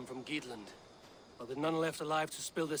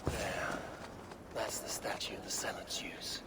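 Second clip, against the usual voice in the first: whisper; 5 semitones lower, 4 dB quieter than usual. Acted. Sneaking up on the statue.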